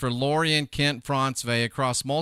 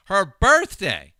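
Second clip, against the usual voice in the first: louder, drawn-out exclamation; exclaimed disbelief; 5 semitones higher, 8 dB louder, slower than usual. Real, not acted. Host stunned that even her birthday is in question, exclaimed disbelief. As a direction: repeat it slowly and emphatically, aghast. Indignant repeat of the birthday point.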